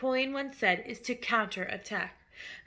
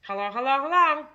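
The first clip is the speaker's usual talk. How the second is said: a parrot voice, louder on the last hello; loud parrot call; 3 semitones higher, 6 dB louder than usual. A narrator's reading. The hidden parrot calls to the family, loud and clear. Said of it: "a voice came loud and clear".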